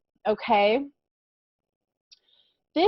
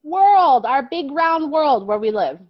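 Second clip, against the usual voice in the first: excited; 6 semitones higher, 5 dB louder than usual. Shown as a demonstration. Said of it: "Next word".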